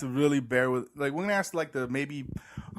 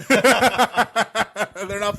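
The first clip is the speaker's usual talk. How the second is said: laughing; 8 semitones higher, 11 dB louder, much slower than usual. Real, not acted. Laughing at own joke.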